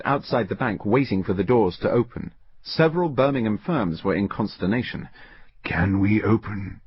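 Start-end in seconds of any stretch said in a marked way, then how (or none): 5.6-6.8 slow, hoarse whispered question. A narrator's reading. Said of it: "whispered Crosby hoarsely".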